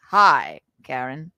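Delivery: a deep, smooth "Hi, Karen"; low bachelor voice. Retold or imitated. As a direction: drop the voice low, suave. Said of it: "he goes".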